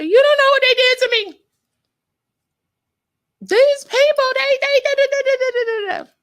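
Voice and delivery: high, loud, wailing sob-talk; crying complaint. Retold or imitated. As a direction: wail it out like a hurt child. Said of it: "and I just start crying".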